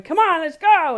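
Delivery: loud, high, excited call to get moving; bright rallying shout to a toddler; eager call. Retold or imitated. Mother calling her toddler son to hurry out, loud and excited. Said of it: "my little three-year-old Forrest going".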